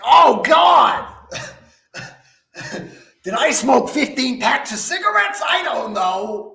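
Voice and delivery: high, loud mocking shout, easing off; Aquafina. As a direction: wail it high, hammed-up celebrity mockery. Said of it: "That's just how she comes off".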